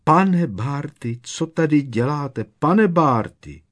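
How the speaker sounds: high, loud, slow wail of a frightened house-elf; piercing shriek. Acted. House-elf finding her master, high piercing shriek. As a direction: shriek the master's name in panic. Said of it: "pronikavě zaječela".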